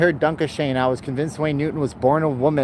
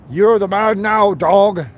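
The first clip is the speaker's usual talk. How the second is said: loud, higher movie-quote voice; Sean Connery; 4 semitones higher, 4 dB louder, slower than usual. Acted. Livestreamer quotes a film to thank a viewer, Sean Connery impression. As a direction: punch it out as a hammed-up quote. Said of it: "to quote Sean Connery".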